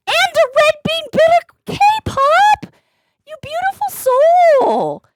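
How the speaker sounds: very high, loud, drawn-out cheer; elated shout-out. Real, not acted. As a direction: belt out the thanks, stretching every word. Celebrating another gift from the viewer.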